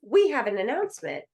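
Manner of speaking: playful mock toast call; glass-tapping announcement. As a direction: mimic a host tapping a glass for attention. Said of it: "and they go".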